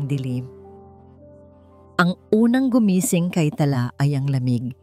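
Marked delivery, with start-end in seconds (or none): none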